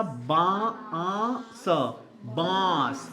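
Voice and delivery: बांस sounded out slowly with nasal आ; बांस, long nasalized vowel. Shown as a demonstration. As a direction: stretch the vowel of बांस through the nose. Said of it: "नाक से".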